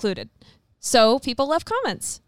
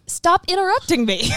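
laughing; 6 semitones higher, 3 dB louder than usual. Real, not acted. Laughing protest during argument.

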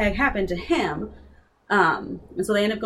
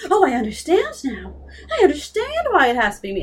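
sudden realization; 8 semitones higher than usual. Retold or imitated.

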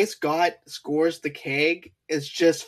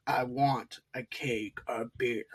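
lower, softer, slow menacing growl; slow low werewolf threat; 4 semitones lower, 8 dB quieter, slower than usual. Retold or imitated. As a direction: let the wolf speak through the words. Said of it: "his eyes start glowing".

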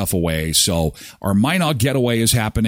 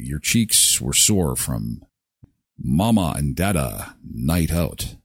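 low, slow insinuating tease; slow sly innuendo; teasing; 8 semitones lower, 3 dB quieter, slower than usual. Pretend. Teasing date night parents.

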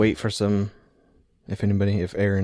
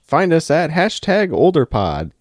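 podcast outro plug; 7 semitones higher, 5 dB louder, slower than usual. Acted.

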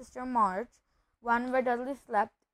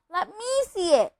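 high, loud, bratty demand; 8 semitones higher, 6 dB louder than usual. A narrator's reading. Son demanding to see the letter, demanding. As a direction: demand a look at once. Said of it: "demanded Dudley".

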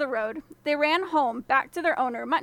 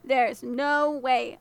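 usual speaking voice

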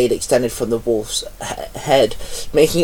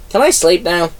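higher, small tired voice; sleepy plea; 5 semitones higher than usual. A narrator's reading. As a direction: ask like a worn-out little creature. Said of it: "Komori asked".

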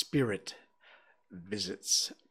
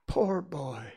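pity; 5 semitones higher than usual. A narrator's reading. Scrooge pitying his former lonely self, gently sorrowful. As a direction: say it with understated pity. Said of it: "in pity for his former self".

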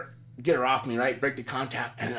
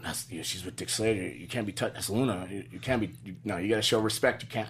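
lower, hushed warning; 6 semitones lower, 6 dB quieter than usual. Retold or imitated. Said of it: "He's like".

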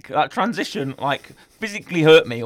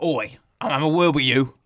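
lightly voiced thuggish cat; Vinnie Jones' cat; 4 semitones lower than usual. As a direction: menace him like a hard man. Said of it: "Vinnie Jones' cat is like".

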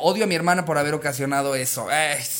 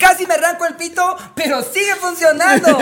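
high, loud, hyped ad voice; infomercial testimonial; 11 semitones higher, 5 dB louder than usual. Pretend. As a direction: sell it like a TV ad. Mock toy commercial testimonial.

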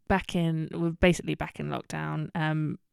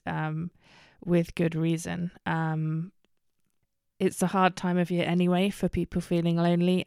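usual speaking voice

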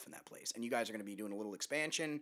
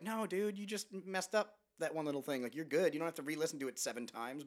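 high, brushing-off friend voice; reassuring friends; 6 semitones higher than usual. Retold or imitated. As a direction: talk him down, high and breezy. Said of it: "They're like".

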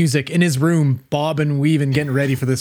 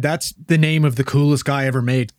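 ordinary delivery

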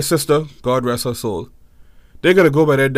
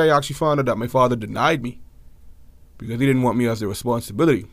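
normal talking voice

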